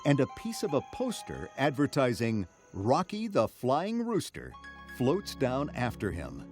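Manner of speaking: usual speaking voice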